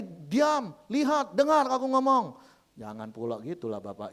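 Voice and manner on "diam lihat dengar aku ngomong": loud, high-pitched barked orders; bossy shouting. Acted. Wife ordering her tired husband to listen, shouting. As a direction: shout each command to demand his attention.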